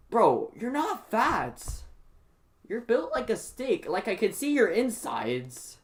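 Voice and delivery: high, drawn-out incredulous protest; exasperated disbelief. Retold or imitated. Student telling skinny classmate she is not fat, exasperated. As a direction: stretch the words in exaggerated disbelief.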